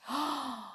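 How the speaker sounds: hushed gasp of excitement; soft gasp. A narrator's reading. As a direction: gasp softly. Narrator gasps at excitement.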